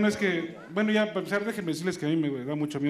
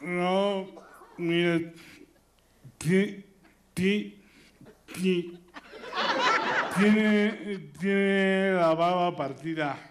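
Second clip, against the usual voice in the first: doctor's voice; 3 semitones higher, much slower than usual. Acted. Doctor voice in joke.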